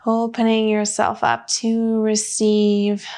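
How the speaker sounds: slow, even meditation voice with long pauses; unhurried guidance, leaving room for breath between phrases; guided meditation. Real, not acted. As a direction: lead them gently inward, phrase by measured phrase.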